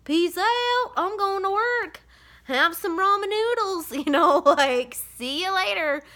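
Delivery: high, carefree mock send-off; imagined mom leaving the kids with ramen, breezy; breezy goodbye. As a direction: wave them off like a mom heading to work. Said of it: "be like".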